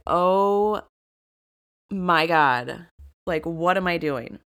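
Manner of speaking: mild dismay. Retold or imitated. Hungover woman retells waking up, mildly dismayed. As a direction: let "oh my God" land heavily in pieces.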